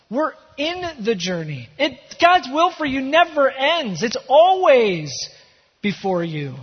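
ordinary delivery

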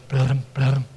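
low, soft rumbling hoofbeats; imitated hoofbeats. Preacher imitating hoofbeats out in the distance, low rumble. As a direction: make distant galloping noises with your voice. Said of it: "hoofbeats out in the distance".

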